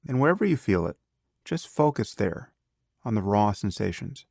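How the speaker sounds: calm, unhurried meditation guide with long pauses; guided meditation. Acted. Teacher leading a beginner through breath awareness, calm guided meditation.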